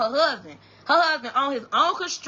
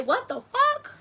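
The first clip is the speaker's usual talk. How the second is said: shocked; 10 semitones higher, slower than usual. Retold or imitated. Shocked reaction on phone.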